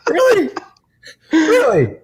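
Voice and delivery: high, incredulous Really; exaggerated double take at a picky critic; mock disbelief. Reacting to the critic's letter with high mock disbelief. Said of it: "I'm like".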